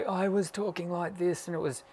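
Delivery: a mild demo of the damaged dysphonic voice. Shown as a demonstration. Voice teacher showing students how her dysphonic voice sounded. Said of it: "I was dysphonic".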